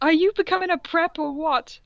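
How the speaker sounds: lightly voiced snooty teen girl; a hint of a bratty goth girl; mock valley girl. Reading fanfic character line.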